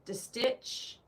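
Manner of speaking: "De-stitching" said slowly, syllable by syllable. Shown as a demonstration. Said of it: "Bubba says".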